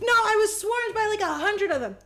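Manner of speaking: high, loud mock protest; defensive excuse. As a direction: plead your case in mock desperation. Acted excuse after being scolded.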